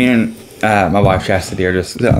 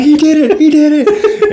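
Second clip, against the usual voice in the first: much higher and louder, euphoric; ecstatic shout; 4 dB louder, slower than usual. Retold or imitated. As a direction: shout it like your driver just won. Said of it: "I'm like".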